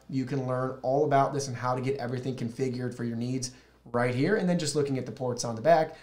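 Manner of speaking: usual speaking voice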